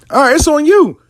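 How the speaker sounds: fine, do what you want, it's on you; exasperated give-up. Retold or imitated. Letting a stubborn client have her way, exasperated.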